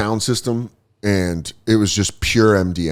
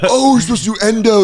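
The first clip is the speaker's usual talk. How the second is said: mock realisation; 12 semitones higher, 4 dB louder, faster than usual. Acting out the mixed-up doctors.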